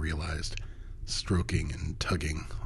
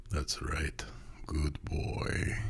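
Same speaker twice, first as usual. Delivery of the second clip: growled low; 5 dB quieter, much slower than usual. A narrator's reading. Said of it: "he growled over me".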